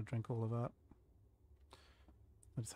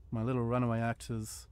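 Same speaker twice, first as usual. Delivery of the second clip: ordinary talk, nothing marked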